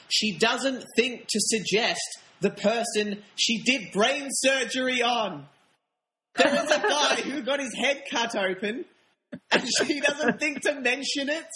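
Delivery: high-pitched exasperated rant; incredulous. Real, not acted. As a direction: voice climbing in disbelief at an absurdity. Incredulous rant about a plot hole.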